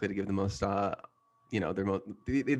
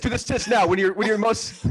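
high, loud hammed-up urging; high loud mock-frantic command; 13 semitones higher, 9 dB louder than usual. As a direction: push him hard, pitched up. Villain urging doctor.

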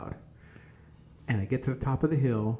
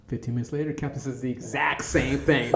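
ordinary talk, nothing marked

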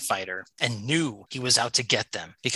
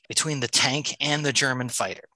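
ordinary talk

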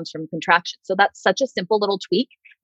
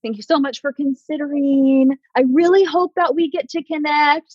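timid sign-off; 7 semitones higher than usual. Retold or imitated. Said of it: "do not end their pitch with confidence".

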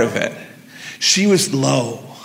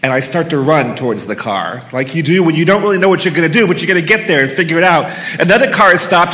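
ordinary delivery

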